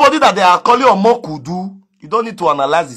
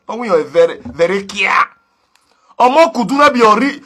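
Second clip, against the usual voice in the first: ordinary talk, nothing marked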